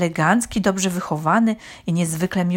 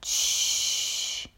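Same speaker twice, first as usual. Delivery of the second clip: soft, drawn-out shush; whispered long shh; hushing; 8 dB quieter than usual. A narrator's reading. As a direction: hush everyone to stillness. Hushing the others before watching.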